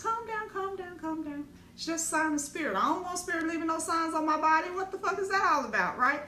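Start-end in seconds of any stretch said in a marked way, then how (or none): 0.0-1.5 soothing friend. Retold or imitated. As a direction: settle her down gently, like a friend calming a panic. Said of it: "And she just".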